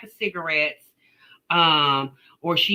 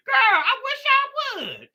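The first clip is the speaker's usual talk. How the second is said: laughing shout; 3 dB louder than usual. Real, not acted. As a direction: shout it while cracking up, daring anyone. Laughing defiance at Jasmine.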